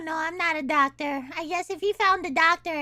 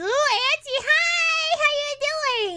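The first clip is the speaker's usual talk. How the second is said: high, loud, drawn-out greeting; exaggerated fake falsetto hello; 7 semitones higher, 5 dB louder, much slower than usual. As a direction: gush hello in a put-on high voice. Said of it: "He's faking his voice".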